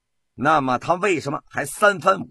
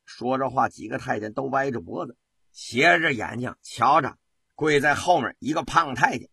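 usual speaking voice

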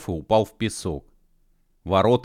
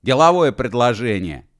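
loud, higher offer; urgent call; 4 semitones higher, 7 dB louder than usual. Acted. Mashkov to the departing girl, loud urgent call. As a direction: call it out to stop her. Calling after the moving platform.

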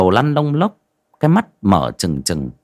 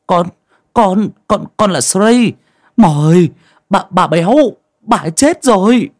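high-pitched, stammering report; nervous, halting and pitched up; timid young man; 10 semitones higher, 6 dB louder than usual. A narrator's reading. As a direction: stammer out bad news to a feared elder. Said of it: "cố dịu giọng".

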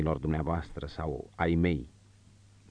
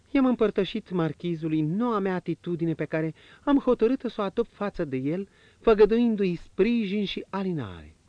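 higher, lighter woman's voice, confiding; marchioness's voice; 13 semitones higher than usual. Acted. Marchioness confiding to the prince, in a higher woman's voice.

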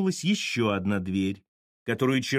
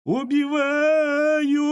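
very high, long-drawn wail, louder; thin wailing; 5 dB louder, much slower than usual. A narrator's reading. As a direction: wail it thinly, stretching the vowels. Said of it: "тоненько, словно примиряясь, заголосила Вера Семёновна".

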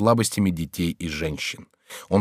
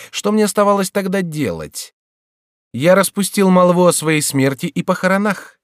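higher, louder teasing voice; mocking jester Chicot; 6 semitones higher, 4 dB louder than usual. A narrator's reading. Chicot's ghost mocks the frightened king, higher and louder. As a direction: taunt the king like a sly jester.

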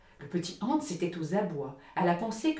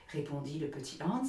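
usual speaking voice

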